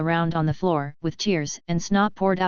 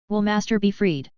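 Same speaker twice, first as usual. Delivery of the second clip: ordinary delivery, nothing marked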